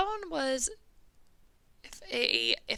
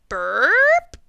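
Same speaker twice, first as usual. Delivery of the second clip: loud mock burp; dragon burp; 3 semitones higher, 9 dB louder than usual. Dragon burping out the animals, a loud mock burp. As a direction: belch it out loud.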